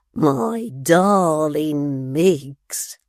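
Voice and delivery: very slow, syrupy lover's plea; mock tender wooing. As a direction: melt her with sweet talk. Tappertit woos her.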